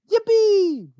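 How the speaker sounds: very high, loud whoop; joyful shout. A narrator's reading. Grandpa Joe rocketing upward, whooping loud. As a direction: whoop with glee. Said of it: "shouted Grandpa Joe".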